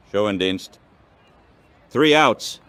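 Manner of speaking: normal talking voice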